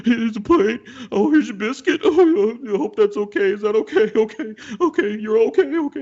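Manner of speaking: high, loud tearful waitress impression; openly sobbing, imitated. Waitress serving diners while openly crying, imitated sobbing. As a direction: act out blubbering while trying to stay polite. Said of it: "she's just openly crying while she's serving us".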